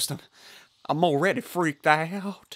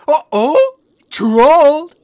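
mocking trolled; 11 semitones higher, 14 dB louder, much slower than usual. Real, not acted. Mocking the victim.

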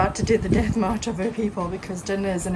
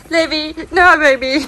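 calling out; 6 semitones higher, 7 dB louder than usual. Real, not acted. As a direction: call him off, loud. Calling to toddler.

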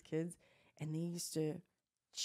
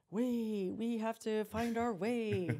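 put-on slow, higher child-at-play voice; imitated little girl; 5 semitones higher, much slower than usual. Toddler playing explorer in her stroller, slow mock-dramatic voice. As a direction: lightly mimic a kid's dramatic make-believe.

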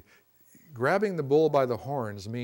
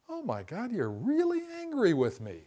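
mock surprised acknowledgment; 10 semitones higher than usual. Shown as a demonstration. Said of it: "We don't say".

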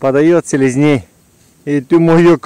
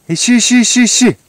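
excited dog command; 8 semitones higher, faster than usual. Real, not acted. Sending dog to search.

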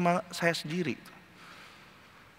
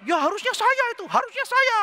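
high, louder whiny envy; envious inner voice; 3 dB louder than usual. Retold or imitated. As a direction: whine it up high like a jealous man. Voicing Asaf's envy. (suara iri tinggi merengek)

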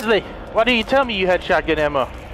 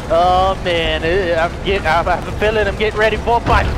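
ordinary talk

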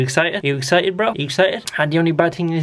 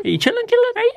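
squeaky hello to the dog; high squeaky dog hello; high pet greeting; 14 semitones higher, much faster than usual. Acted.

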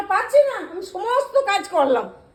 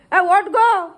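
calling out; 7 dB louder, much slower than usual. Acted. Calling wife to wake up.

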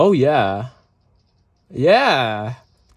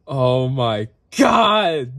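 drawn-out excited shout; 3 semitones lower, much slower than usual. Real, not acted. Finding the song dad used to play, slow excited shout. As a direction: stretch the cry out in delight.

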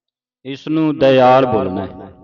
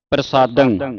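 ordinary talk

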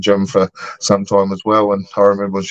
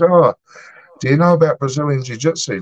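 retold eager interest; 6 semitones higher than usual. Pub worker to Brazilian lady about jiu-jitsu, eagerly. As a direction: act out being hooked, pitched up. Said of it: "he was hooked".

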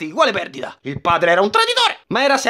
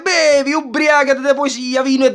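raised, boozy party cheer; drunken shout; 5 semitones higher than usual. Acted. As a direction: bellow it like a happy drunk. Drunk knight celebrates.

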